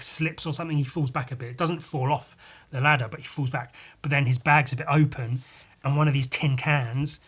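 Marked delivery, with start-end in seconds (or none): none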